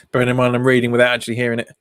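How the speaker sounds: normal talking voice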